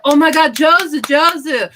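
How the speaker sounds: loud, high imitation of excited praise, Jozu drawn out slowly; mock gushing praise. Japanese people praising a tiny thing, loud high gush. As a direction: cheer Jozu, Jozu like a thrilled local.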